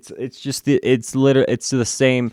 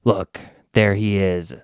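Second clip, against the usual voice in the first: lightly voiced hero; 6 semitones lower than usual. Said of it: "they say".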